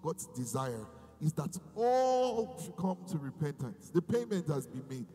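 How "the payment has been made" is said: ordinary talk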